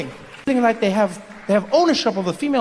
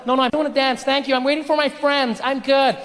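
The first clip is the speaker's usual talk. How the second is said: higher, hurried brush-off; higher-pitched voice of a pestered woman; woman refusing advances; 5 semitones higher than usual. Acted. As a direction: fend off a creep politely. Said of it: "you're in a compromising position".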